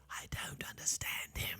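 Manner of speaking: barely voiced panicked whisper; mouthed whisper. Interpreter secretly mouthing to the pastor, whispered. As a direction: breathe it without voice. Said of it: "she mouths".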